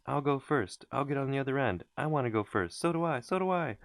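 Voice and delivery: put-on high, eager children squabbling; children clamoring to go first; excited shouting. A narrator's reading. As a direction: kids fighting over turns, shouting. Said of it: "shouting with excitement".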